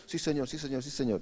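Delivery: very soft, timid yes; meek assent. Acted. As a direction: mumble humble agreement. Disciples' meek agreement voiced.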